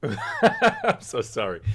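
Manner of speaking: high, slow, giggling sorry; laughing apology. Real, not acted. Host laughing at his own remark, sorry through giggles. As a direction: apologize while laughing helplessly.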